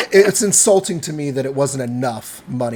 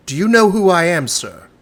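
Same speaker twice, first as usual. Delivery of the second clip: haughty demand, louder and higher; indignant; 5 semitones higher, 7 dB louder than usual. Acted. Noble snapping at the barkeep, louder and higher indignant. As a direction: puff up, offended noble.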